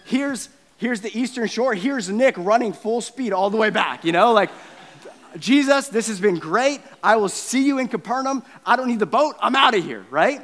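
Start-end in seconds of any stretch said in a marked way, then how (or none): 5.4-10.1 high, loud, rushed-off farewell; panicked hasty exit. Acted. Nick running from the scary man, hollering back to Jesus, high and loud. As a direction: blurt it high and loud, eager to get away. Acts Nick fleeing at full speed.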